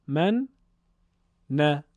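"من، نه" in two separated beats; syllable-by-syllable reading. Shown as a demonstration. Teacher demonstrating syllable reading, "من، نه" split by a pause. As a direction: say "من", wait, then a short "نه". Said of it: "این بخشخوانی حرف مشدد".

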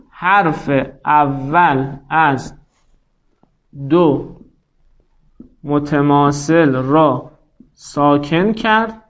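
very slow, word-by-word dictation with long pauses. Shown as a demonstration. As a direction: dictate it slowly for note-takers. Teacher dictating rule for writing.

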